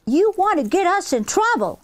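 high, tense accusation; hissed accusation. Acted. Kim accusing Henrietta of making trouble, hissing. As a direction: hiss it sharply at a sister. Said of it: "Kim hissed".

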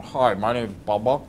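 chubby jowls, stuffed-mouth puppet voice; muffled fat-headed character; Bubba. Shown as a demonstration. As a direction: introduce yourself with paper stuck in your mouth. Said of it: "like he's got a piece of paper stuck in his mouth".

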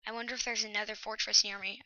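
ordinary delivery

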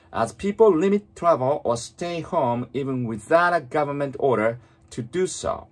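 very slow, fully articulated English reading; read-aloud model. Shown as a demonstration. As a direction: move the lips fully, reading slowly for shadowing. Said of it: "本当に大きな声で唇を思いっきり動かして". (口を大きく動かしてゆっくり音読)

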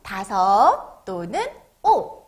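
slow words with stretched endings; read-along model. Shown as a demonstration. Teacher has children read the number five along, slow read-along model. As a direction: say it slowly for children to echo. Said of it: "선생님하고 따라서 읽어보도록 할게요".

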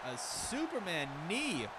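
sudden high shout; excited shout. Real, not acted. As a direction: yell it out in shock. Big move off second rope.

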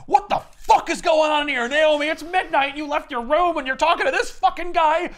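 high, loud mock tirade rising to a shout; hammed-up screaming mom; furious mom. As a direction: rage at the daughter like an angry mother. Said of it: "She's just like".

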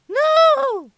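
put-on high, loud child shout; defiant yell. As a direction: shout back at mom like a kid. Acted quarrel with mom.

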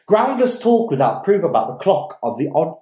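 plain reading voice

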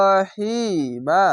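slow drawn-out Quranic chant; Quranic recitation. Shown as a demonstration. Teacher demonstrates the word to students, slow recitation. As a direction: recite it slowly with full elongation. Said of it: "ফা ইয়াজের ফি".

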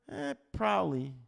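noncommittal, high, stretched reply; wishy-washy mock answer; watered-down answer. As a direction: hedge it with a doubtful "eh". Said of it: "in our watered down language, we say".

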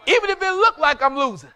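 loud, rising preacher's cry; preaching shout. Real, not acted. Preacher calling out to the church, loud. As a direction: lift the congregation with it.

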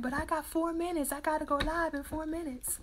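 a touch of pressed urgency; retold protest. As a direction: plead your case, lightly acted. Acts out her protest to Anthony.

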